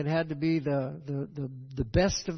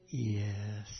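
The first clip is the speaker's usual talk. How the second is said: 1 sheepish yes; 7 semitones lower, 8 dB quieter than usual. Retold or imitated. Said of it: "take the hit".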